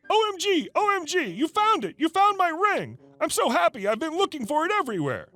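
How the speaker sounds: high, squealing mock delight over the ring; fake excitement. Liar to clerk, faking joy over a found ring. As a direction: gush like you just found your lost treasure. Lady fakes finding her ring.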